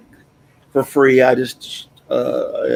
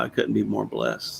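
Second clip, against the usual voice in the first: softer, lower "I couldn't be more blessed"; soft and quiet; 3 semitones lower, 10 dB quieter than usual. Real, not acted. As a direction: let "I couldn't be more blessed" fade out softly. Repeats gratitude, trailing off.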